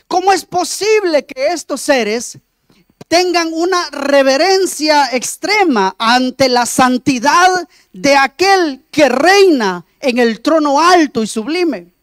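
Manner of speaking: normal talking voice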